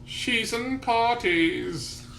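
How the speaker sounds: high, slow nasal croon; nasally singer voice. Retold or imitated. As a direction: sing it through the nose. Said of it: "a weird nasally voice is like".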